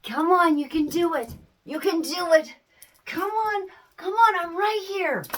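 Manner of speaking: high, eager encouragement; coaxing a toddler. Retold or imitated. Parent kneeling, urging baby to walk, high coaxing. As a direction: cheer the baby on to take a step. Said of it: "you're just like".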